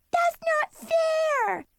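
put-on very high, drawn-out kid tantrum; screaming little sister. A narrator's reading. Little sister screams at big sister, high tantrum. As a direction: squeal it slowly in outrage. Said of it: "she screamed at me".

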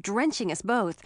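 plain reading voice

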